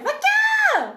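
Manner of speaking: very high, laughing shriek; mock Romanian exclamation. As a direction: squeal the joke catchphrase at full pitch. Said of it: "what the Romanians would say".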